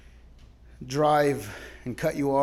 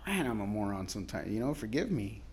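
sheepish quiet prayer; 7 semitones lower, 9 dB quieter than usual. Retold or imitated. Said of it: "I was like".

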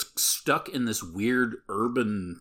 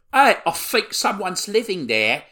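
put-on much higher, louder girl calling out; chav girl voice; 13 semitones higher, 9 dB louder than usual. Girl in the garden calling to her friend in a high voice. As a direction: squeal it like a rough teen girl. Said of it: "hears some girl go".

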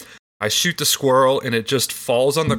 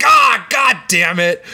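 dad's angry shout; 8 semitones higher, 7 dB louder, much slower than usual. Retold or imitated. Dad sees twitching squirrel and curses in a loud shout. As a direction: bellow it like a fed-up father. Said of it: "he's like".

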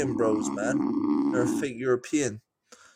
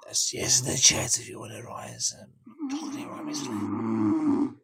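whisper; 2 semitones lower, 6 dB quieter than usual. Acted. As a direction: lean in and whisper the offer. Whispered offer to chat.

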